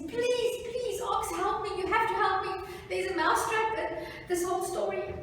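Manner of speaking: high-pitched, urgent mouse plea; pleading mouse. Acted. Mouse begs the ox for help, high and urgent. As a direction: beg insistently in a high put-on voice.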